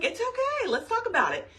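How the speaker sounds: high, comforting mock voice; imitating husband. Husband reassuring his crying wife, high mock voice. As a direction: soothe her in a sing-song imitation. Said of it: "And he's like".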